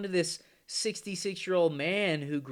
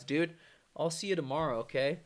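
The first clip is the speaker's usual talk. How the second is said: ordinary talk, nothing marked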